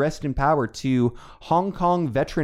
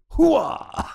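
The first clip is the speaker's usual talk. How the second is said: Al Pacino impression; 13 semitones higher than usual. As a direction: bark it like Pacino waking. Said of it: "that's what he says".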